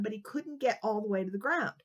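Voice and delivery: usual speaking voice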